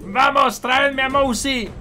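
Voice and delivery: a hint of a barking villain; villain commanding. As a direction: give orders like a villain boss. Villain orders his army.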